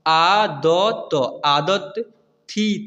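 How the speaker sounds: "आदत" stretched out very slowly, syllable by syllable; drawn-out demo. Shown as a demonstration. Teacher showing pupils how to read the word, very slow. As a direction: spell it out slowly for beginners. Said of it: "ऐसे clear हुआ".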